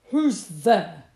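loud, grumpy badger growl. A narrator's reading. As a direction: growl at whoever is at the door. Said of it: "growled Badger".

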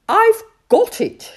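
high, loud shout of success; triumphant toad calling out. A narrator's reading. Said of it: "shouted Timid Toad".

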